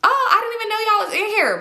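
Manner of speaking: high, lively surprise; surprised friend. Retold or imitated. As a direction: act startled to find them. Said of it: "So she was like".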